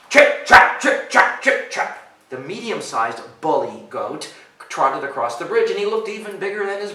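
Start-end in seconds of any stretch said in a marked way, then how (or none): 0.1-2.1 loud voiceless chip-chap; hoofbeat imitation. Reader mimicking bigger goat on bridge, hard and percussive. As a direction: stamp out heavy hoofsteps with sharp consonants.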